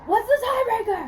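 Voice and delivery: loud, high laughing call. Real, not acted.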